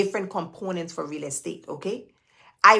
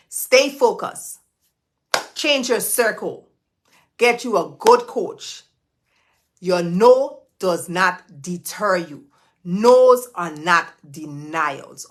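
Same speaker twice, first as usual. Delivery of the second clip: slow emphatic advice; 6 dB louder, slower than usual. Real, not acted. Motivational advice, slow punches.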